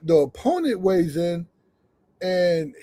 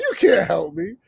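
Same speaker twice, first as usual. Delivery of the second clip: amused dismissal; 8 semitones higher than usual. Retold or imitated. Laughing dismissal of announcer.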